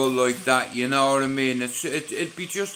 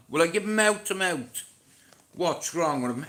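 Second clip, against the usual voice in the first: ordinary delivery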